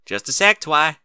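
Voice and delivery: calling back. A narrator's reading. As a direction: shout toward the door. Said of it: "Applejack called".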